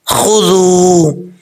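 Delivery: slow खुजू with a clean catch on the hamza; glottal catch. Shown as a demonstration. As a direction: stop the throat briefly in खुजू. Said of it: "अब झटका कैसे देना है?".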